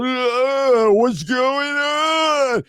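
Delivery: high, slow, dazed and confused; a drowsy high-pitched mumble of confusion; groggy half-awake voice. Retold or imitated. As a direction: act out waking up woozy and lost. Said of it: "blurry and, like, doozy out".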